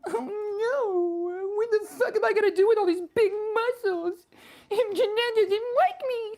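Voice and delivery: very high, squeaky fake bawling; mock crying. Streamer mocking a departing viewer, mock crying. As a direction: wail in a tiny high fake sob. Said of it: "so that you can see me cry".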